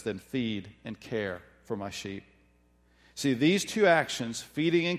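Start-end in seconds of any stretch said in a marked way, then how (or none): 0.0-2.2 soft final charge. Acted. Jesus's final instruction.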